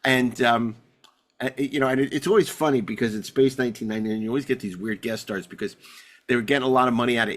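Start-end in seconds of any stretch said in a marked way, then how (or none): none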